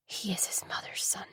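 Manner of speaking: breathy whispered aside. A narrator's reading. Narrator's bitter private thought, whispered.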